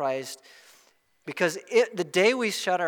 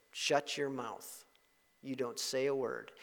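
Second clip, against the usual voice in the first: menacing hush; 5 semitones lower, 10 dB quieter than usual. Retold or imitated. Devil voiced intimidating.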